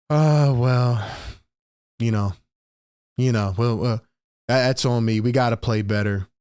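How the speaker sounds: low, limp mumble with lots of "uh"; mocking sleepy Hurts. Fan mocks Jalen Hurts' drowsy press talk, low limp mumble. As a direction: imitate a listless quarterback at the podium. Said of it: "he looks like he's about to fall asleep".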